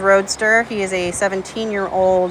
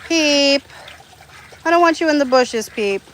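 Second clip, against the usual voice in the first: calling out; 6 semitones higher than usual. Real, not acted. Owner calling her duckling out of the bushes, high call. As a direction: call him back out of the bushes.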